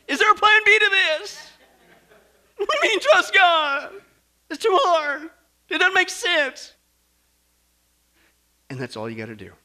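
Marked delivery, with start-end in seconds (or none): 0.1-6.7 high, loud mock desperation; panicked protest. Preacher mimics believers balking at God, panicked protest. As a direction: wail it in hammed-up dismay. Acting out our panicked distrust.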